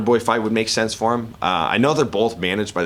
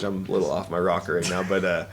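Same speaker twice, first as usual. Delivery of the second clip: ordinary talk, nothing marked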